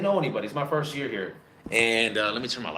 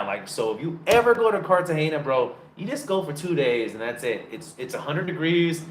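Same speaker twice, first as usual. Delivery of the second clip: normal talking voice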